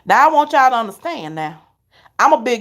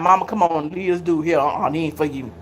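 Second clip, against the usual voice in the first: lower, softer voice of her son; soft lowered son's voice; imitating her son; 6 semitones lower, 4 dB quieter than usual. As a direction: voice the son brushing it off.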